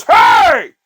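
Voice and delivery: put-on a short, loud barked "Hey!" from a tough boyfriend; mock angry shout. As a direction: yell it like a muscle guy turning around to confront someone. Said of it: "He turns around and goes".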